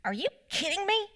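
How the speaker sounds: put-on high kid's voice, fed up, are you kidding me; imitating exasperated four-year-old. Mom imitating her four-year-old son's exasperated outburst, high kid voice. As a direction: mom voicing her son copying her road rage. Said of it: "and he goes".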